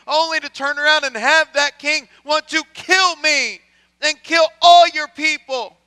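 loud, high, pleading lament; Mordecai's anguished prayer. Acted. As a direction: plead with God, hurt and accusing. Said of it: "I can imagine his prayer".

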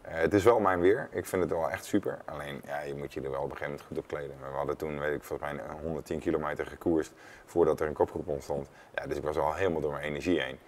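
ordinary talk

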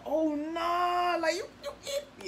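very high, teasing "You eat"; mock disbelief. Shown as a demonstration. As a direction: tease a friend eating. Someone eating a glizzy.

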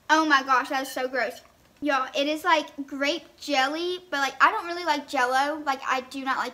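sipping between words; talking around a straw. Real, not acted. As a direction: lips on drink straw. Seen 0: No chewing visible; she sips the drink straw near the end.

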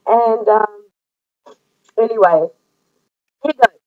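ordinary delivery

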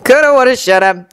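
irritable. A narrator's reading. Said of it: "said an irritable voice in his head".

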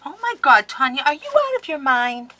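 high, loud disbelieving cry; overwhelmed exclaim. Real, not acted. To Tanya about her gift, loud high disbelief. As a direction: blurt it out, stunned by the gift.

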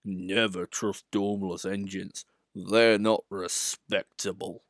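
slow, heavy suspicious big engine; dark Gordon. Acted. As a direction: say it darkly and slowly. Gordon continues.

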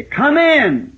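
high Southern call; "Come in" called high and friendly. Retold or imitated. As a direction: welcome them in from the porch. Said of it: "You'd say".

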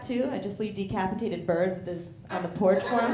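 usual speaking voice

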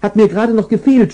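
high, fast, exasperated cry; mock shout. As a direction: blurt it out in a panic. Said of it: "schrie Frauchen".

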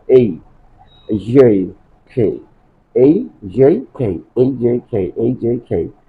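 এই and খে spaced, then grouped; slow practice drill. Shown as a demonstration. Teacher starting breath practice, এই and খে carefully modelled. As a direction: glide each এই and puff each খে. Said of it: "ধীরে ধীরে উচ্চারণ করা".

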